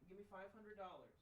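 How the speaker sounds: high, teasing, slowed joke to the dog; playful pet talk. Real, not acted. Owner jokingly asking her dog for money, high teasing voice.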